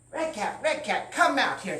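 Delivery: loud, high call. Acted. Farmer calling the leprechaun out, loud. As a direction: call across the yard.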